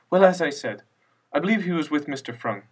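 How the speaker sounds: a hint of a flustered grandmother; lightly voiced elderly widow, vague and dismissive; frail old widow.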